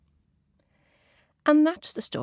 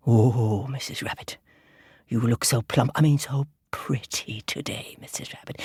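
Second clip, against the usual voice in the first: sly fox; 7 semitones lower than usual. Acted. Fox to Missus Rabbit, low sly flattery. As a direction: flatter slyly in a lower voice. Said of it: "said Foxy".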